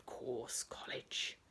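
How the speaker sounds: low, slow, sinister whisper; ominous whisper. Acted. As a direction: breathe the name like a threat. Naming the cheating rival school.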